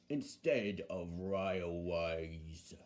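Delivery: slow, low, quiet bus voice; Bulgy. Acted. Bulgy preferring roads to railways, slow low distaste. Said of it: "said Bulgy".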